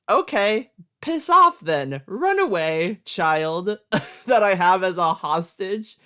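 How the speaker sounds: loud, high mock villain dismissal; dismissive shout. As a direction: bark it off, careless villain. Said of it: "he just goes".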